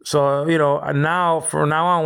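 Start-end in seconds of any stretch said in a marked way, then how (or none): none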